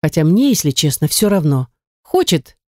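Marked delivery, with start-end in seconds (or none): none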